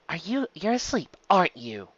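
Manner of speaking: usual speaking voice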